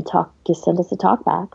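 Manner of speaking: usual speaking voice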